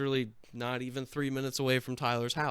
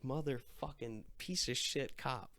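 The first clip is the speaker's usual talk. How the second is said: muttered curse; 10 dB quieter than usual. Retold or imitated. Cop laughs at him, cursing.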